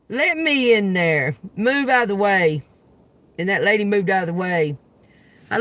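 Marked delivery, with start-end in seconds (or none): 0.1-2.6 loud, slow, pushing through; commanding, forceful. Retold or imitated. To the old lady at the stall, loud and commanding. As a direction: take charge at the stall door.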